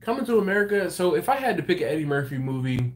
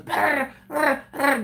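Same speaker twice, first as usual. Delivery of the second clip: mock machine gun; 12 semitones higher, 3 dB louder, slower than usual. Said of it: "four of 'em with the Tommy guns".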